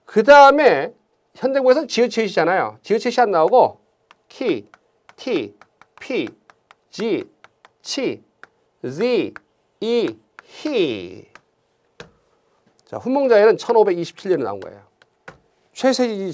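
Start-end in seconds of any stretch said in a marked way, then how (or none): 4.3-11.3 키, 티, 피, 지, 치, 이, 히 as separate open syllables, no final consonant; old letter names. Shown as a demonstration. Teacher reciting old consonant names to the class, 키, 티, 피 as bare open syllables. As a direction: recite 키, 티, 피 one by one, low and soft, each ending on an open "ee". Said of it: "이렇게 읽었어요".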